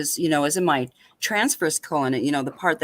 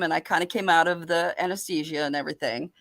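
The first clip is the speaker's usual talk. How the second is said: ordinary talk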